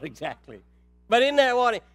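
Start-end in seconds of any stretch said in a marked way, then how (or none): 1.1-1.8 loud, high revival preaching; impassioned preaching. Acted. Pastor preaching hard to the congregation. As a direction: preach it out to the whole church.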